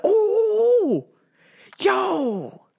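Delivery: high laughing oh yo; excited squeal. Retold or imitated. Excited about his sign.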